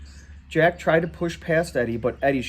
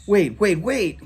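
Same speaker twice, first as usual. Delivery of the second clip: louder, higher calls to stop them; yelling; 6 semitones higher, 4 dB louder, slower than usual. Acted. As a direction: shout them to a halt. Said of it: "yelled the guy with the flashlight".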